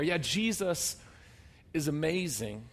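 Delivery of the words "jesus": ordinary talk, nothing marked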